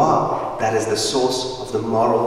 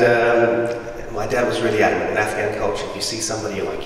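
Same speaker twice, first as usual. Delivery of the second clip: ordinary talk, nothing marked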